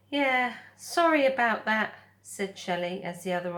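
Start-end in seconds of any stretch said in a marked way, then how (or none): 0.1-2.0 friendly otter voice. Acted. Otter character voice.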